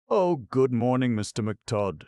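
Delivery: high, sweet cooing greeting. Acted. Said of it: "she cooed".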